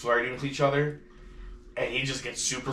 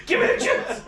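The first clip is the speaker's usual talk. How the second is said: fast, rising to a high squealing cry; chihuahua voice; 6 semitones higher than usual. Retold or imitated. Imitating the chihuahua begging for juice, frantic squeal. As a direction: beg frantically like an addict. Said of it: "she's just like".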